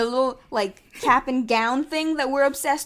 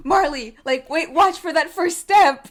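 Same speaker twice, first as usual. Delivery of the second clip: loud, higher warning call; 6 semitones higher, 8 dB louder than usual. Retold or imitated. Scrooge calling after Marley.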